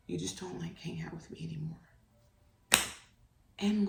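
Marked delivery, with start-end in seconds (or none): 0.1-1.8 whispered, small and hurt; a little boy's whispered reproach; son's sad whisper. Retold or imitated. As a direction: barely voice it like a sad kid. Son whispering his complaint.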